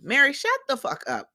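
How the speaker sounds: high, exasperated telling-off; exasperated outburst. Retold or imitated. Recapper tells absent Meri off, exasperated. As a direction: snap it high and forceful.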